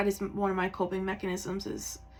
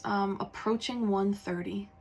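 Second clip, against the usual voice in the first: ordinary delivery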